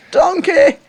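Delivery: high, loud mock-Scottish cry; Shrek. Shown as a demonstration. Co-host coaching the accent by example, loud Shrek impression. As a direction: belt it in a put-on Scottish cartoon accent. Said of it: "that's all you gotta do".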